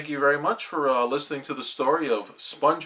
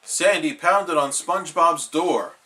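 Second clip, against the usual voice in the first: ordinary delivery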